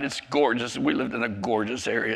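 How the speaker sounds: ordinary talk, nothing marked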